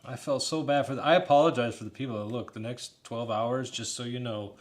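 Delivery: normal talking voice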